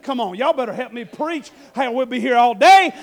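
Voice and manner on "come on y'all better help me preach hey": ordinary delivery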